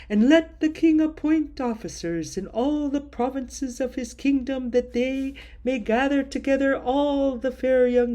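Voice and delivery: king's servants. Acted. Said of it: "Then said the king's servants".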